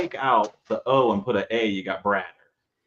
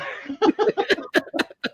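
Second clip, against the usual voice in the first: high airy burst of laughter; very high wheezy laugh; breathy laugh. Real, not acted. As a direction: laugh at the mix-up. Laughing at the slip.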